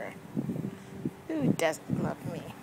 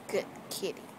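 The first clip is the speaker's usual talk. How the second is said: whispering; 6 dB quieter than usual. Real, not acted. Soothing the cat.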